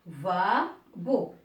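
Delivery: "Lavabo" slowly split into three separate syllables. Shown as a demonstration. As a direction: say "Lavabo" in three slow, detached beats. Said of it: "il contient trois syllabes". (« Lavabo » lentement, syllabe par syllabe)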